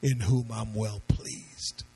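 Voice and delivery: very low, slow divine pronouncement; God speaking, solemn and deliberate; voice from heaven. Acted. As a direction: sink deep and stretch out the words. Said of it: "And then the voice says".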